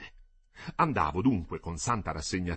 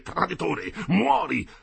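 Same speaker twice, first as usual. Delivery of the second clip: higher and louder furious cry; great loud voice; 8 semitones higher, 5 dB louder, slower than usual. A narrator's reading. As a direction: roar the death threat. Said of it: "ripigliò con gran voce".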